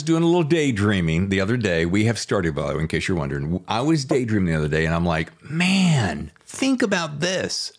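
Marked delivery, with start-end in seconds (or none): 5.5-7.7 high, drawn-out marvelling; slow, high amazement; wowed daydream thought. Retold or imitated. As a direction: retell a thought in awe, pitched up. Acts out own daydream thought.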